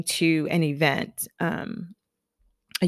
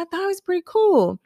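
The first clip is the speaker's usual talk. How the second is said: breezy answer; 11 semitones higher, faster than usual. Retold or imitated. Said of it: "I said".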